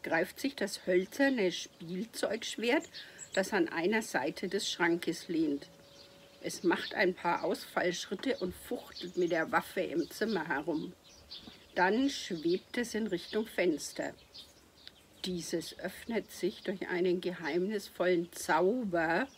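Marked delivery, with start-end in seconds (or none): none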